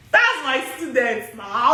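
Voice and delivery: ordinary talk, nothing marked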